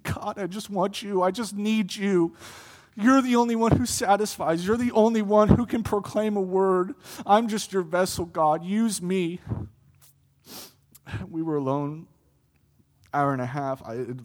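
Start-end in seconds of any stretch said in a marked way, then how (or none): 0.0-9.4 higher and louder, pleading cry; crying out to God. Retold or imitated. Preacher reliving his tearful prayer, louder pleading cry. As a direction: pour out a desperate prayer. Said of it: "a cry to be released from me".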